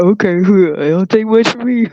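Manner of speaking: slow put-on voice with laughing; mocked eager man. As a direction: imitate a dude, laughing through it. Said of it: "And he's like".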